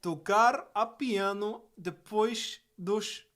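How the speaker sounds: high, loud, slow Portuguese; exaggerated Portuguese. Telling sister no piano after ten, slow exaggerated Portuguese.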